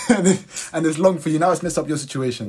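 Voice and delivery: high, loud laughter through words; laughing. Real, not acted. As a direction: laugh while telling it. Laughing at the story.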